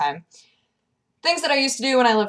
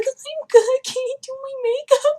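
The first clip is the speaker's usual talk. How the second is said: squeaky reply. Retold or imitated. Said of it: "I was like".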